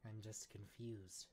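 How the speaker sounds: soft, slow admission of confusion; hushed and hesitant; subdued confession. Acted. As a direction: confess it quietly, drawing the words out. Retake of acted confession.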